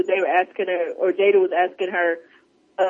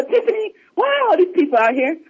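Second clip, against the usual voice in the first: high, baffled questioning; mock bewilderment; 10 semitones higher than usual. [name] asking Tiffany about all these people, mock bewildered. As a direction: imitate demanding an explanation from a friend.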